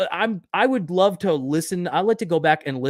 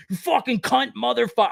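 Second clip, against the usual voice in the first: angry outburst; 9 semitones higher than usual. Retold or imitated. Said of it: "if you guys think I sounded mad".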